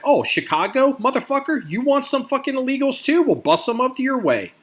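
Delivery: high-pitched mock outrage, hammed-up; mock upset rant. Imitating upset Chicagoans yelling at the governors, high mock outrage. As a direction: squeal it like an indignant caller. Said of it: "people get all, all upset, like".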